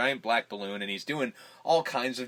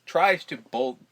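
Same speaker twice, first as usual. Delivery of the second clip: ordinary delivery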